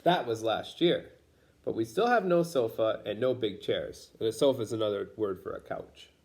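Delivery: plain reading voice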